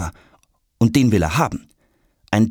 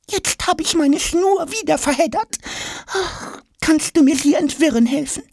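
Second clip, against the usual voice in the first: very high squeaky small-creature voice; tangled little figure. A narrator's reading. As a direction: plead for help as a tiny flustered character. Little brace box in a tangle. (piepsige Figurenstimme, hilfesuchend)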